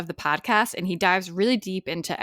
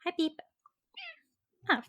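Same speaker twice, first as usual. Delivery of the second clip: high, soft cooing voice for the pet; pet talk; 10 semitones higher, 6 dB quieter than usual. Real, not acted. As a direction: coo at the little dog. Greeting her pet arriving.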